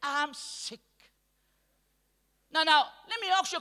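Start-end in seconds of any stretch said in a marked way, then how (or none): 0.0-0.8 mopey sick voice. Retold or imitated. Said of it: "Another colleague sees you".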